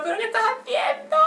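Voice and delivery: high, sharp shriek of pain; high yelp of protest; retold shriek. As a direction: shriek it as the scabs are pulled off. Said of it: "Y yo chillando".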